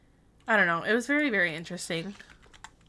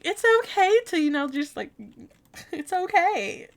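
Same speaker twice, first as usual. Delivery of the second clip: exasperated plea; 9 semitones higher than usual. Retold or imitated. Pleading with strict office staff.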